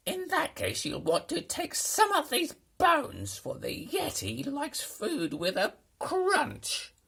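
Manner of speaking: very high, loud, sly creature voice; monster voice. A narrator's reading. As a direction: play the monster giving a tip. Monster Betty advising.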